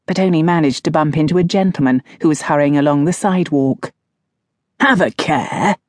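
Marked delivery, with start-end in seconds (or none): 4.8-5.8 huffing. Acted. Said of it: "he huffed".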